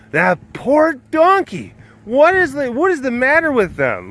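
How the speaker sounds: hammed-up outrage for the donkey; mock dismay. Acting out appalled onlookers.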